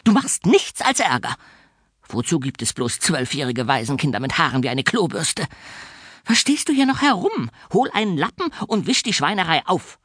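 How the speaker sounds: put-on high, shrill shrieking old spinster; nasty orphanage warden screeching; shrieking matron. A narrator's reading. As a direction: screech the insults down at him. Fräulein Schimmel scolding.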